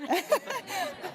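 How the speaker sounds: high bright burst of laughter; happy laugh. Real, not acted. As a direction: laugh out loud with joy. Laughs after telling the story.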